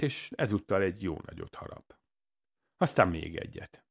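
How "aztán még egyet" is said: ordinary delivery, nothing marked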